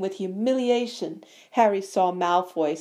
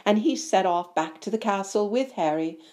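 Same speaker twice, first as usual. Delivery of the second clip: plain reading voice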